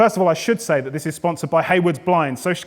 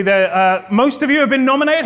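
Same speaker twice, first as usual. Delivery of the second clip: ordinary talk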